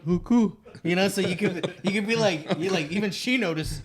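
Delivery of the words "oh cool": high, loud muffled punchline; hammed-up garbled catchphrase; deaf mother-in-law. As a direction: deliver it in her mock deaf voice. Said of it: "she could do the punch".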